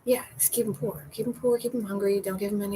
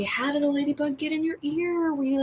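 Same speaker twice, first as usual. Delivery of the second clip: normal talking voice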